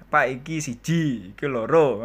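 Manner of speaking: imitating a show-off attempt at Javanese; lightly put-on Javanese speech. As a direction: try out some halting beginner's Javanese. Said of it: "sok-sok aja gitu kan, bahasa-bahasa Jawa".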